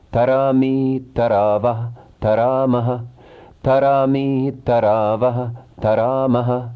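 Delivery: even, measured chanting of verb forms; rhythmic recitation. Shown as a demonstration. Teacher reciting Sanskrit verb forms for students, in a measured chant. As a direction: recite each form in a steady rhythm, like a class drill.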